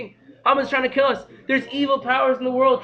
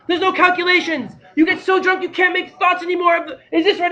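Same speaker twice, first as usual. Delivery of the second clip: ordinary talk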